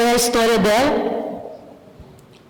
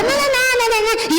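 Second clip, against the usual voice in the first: high, insistent mock no; husband's protest; much faster than usual. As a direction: play the whiny husband refusing. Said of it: "E aí ele vai falar". (marido reclamando, agudo e insistente)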